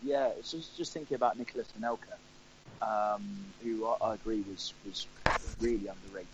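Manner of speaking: normal talking voice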